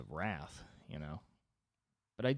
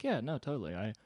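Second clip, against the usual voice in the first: high, chill agreeable voice; God as an easygoing buddy; laid-back God voice; 7 semitones higher, 5 dB louder than usual. Retold or imitated. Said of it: "He's like".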